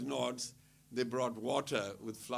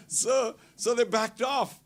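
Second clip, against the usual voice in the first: slow words through laughter; laughing; 10 semitones higher, 9 dB louder, slower than usual. Real, not acted. Telling listeners the crowd retreated, laughing.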